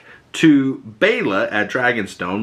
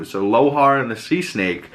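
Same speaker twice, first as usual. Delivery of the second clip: ordinary delivery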